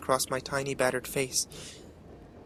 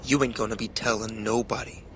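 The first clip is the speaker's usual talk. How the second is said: low, slow threatening father; slow calm menace; 3 semitones lower than usual. A narrator's reading. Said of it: "in that slow, calm way he always spoke".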